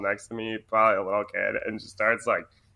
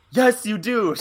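higher, louder mock-stern mother; stern mom; 10 semitones higher, 6 dB louder than usual. Mom insisting her son flush the toilet, loud and stern. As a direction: snap back like a fed-up mom. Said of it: "she's like".

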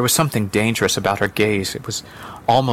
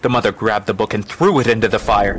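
ordinary delivery

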